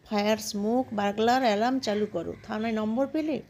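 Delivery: ordinary delivery, nothing marked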